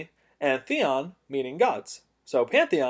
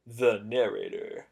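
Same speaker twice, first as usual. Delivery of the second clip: dramatic narrator voice; 6 semitones lower, 7 dB quieter, slower than usual. Pretend. Dramatic self-introduction as narrator.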